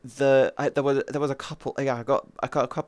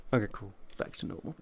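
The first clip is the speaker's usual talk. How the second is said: offhand mutter, quick and soft; 5 semitones lower, 12 dB quieter, faster than usual. Retold or imitated. Sniper shrugging off a flick kill, quick and quiet. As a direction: toss it off under your breath, fast. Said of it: "I'd be like".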